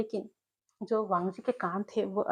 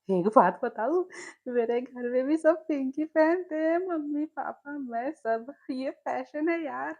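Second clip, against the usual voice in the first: higher, giggly teasing voice; laughing teasing; 5 semitones higher, 3 dB louder than usual. Acted. Jiang Cheng teases Wing about his own pink family, giggly and higher. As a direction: tease him while still laughing. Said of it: "हंसते-हंसते कहा".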